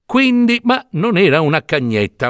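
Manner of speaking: ordinary delivery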